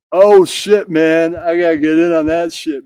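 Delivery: high, loud excited bro. Acted. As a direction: jump in all hyped up. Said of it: "All right, all N-words".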